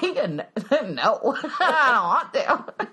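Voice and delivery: mock reluctance through giggles; laughing refusal. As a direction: refuse playfully, laughing as you go.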